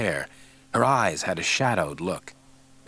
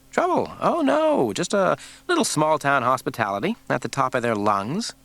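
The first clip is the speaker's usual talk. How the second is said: sarcastic; 5 semitones higher than usual. A narrator's reading. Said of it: "Scully said".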